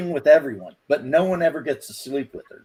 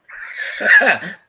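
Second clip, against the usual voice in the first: loud, high real laugh breaking out; cracking up at the punchline; burst of laughter; 6 semitones higher, 6 dB louder than usual. Real, not acted. Laughing at own dark joke.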